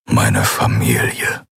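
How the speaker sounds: low, slow, heartfelt man; deep, unhurried confession; brooding man, tender. Acted. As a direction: say it low and slowly, as what matters most.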